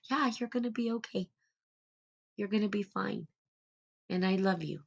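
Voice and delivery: soft, quiet reassurance; soothing inner mother. Acted. Inner mother soothing her inner child, soft and reassuring. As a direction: comfort a frightened child. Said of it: "hold my hands to my heart and say".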